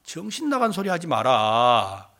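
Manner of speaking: low mock rebuke; dismissive. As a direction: dismiss their story in a deep, unimpressed tone. Disciples dismiss the women. (낮은 소리로 핀잔주는 흉내)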